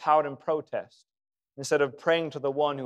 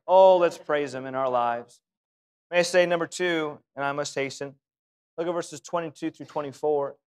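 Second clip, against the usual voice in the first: ordinary talk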